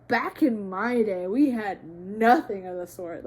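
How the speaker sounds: loud mock boomer; grumbling boomer. Older generation dismissing mental health talk, mock boomer grumble. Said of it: "boomers and older generations being like".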